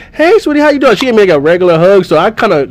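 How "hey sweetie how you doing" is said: high, sweet greeting; a lightly voiced grandma being sweet the next day. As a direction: make it sugary sweet. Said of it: "she say".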